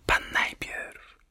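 slow, hushed whisper; szept. A narrator's reading. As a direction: whisper it softly, drawing it out. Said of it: "szepnął w odpowiedzi Piotr". (powolnym, cichym szeptem)

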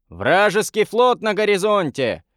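high-pitched narrated cry of alarm; lookout's call. A narrator's reading. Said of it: "крикнул наблюдатель". (высокий окрик дозорного)

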